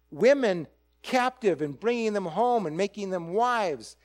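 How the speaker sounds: usual speaking voice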